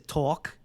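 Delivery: "Talk" with a rounded aw vowel; light accented vowel. Retold or imitated. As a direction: say "Talk" as if spelt T-A-W-L-K. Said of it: "T A W L K".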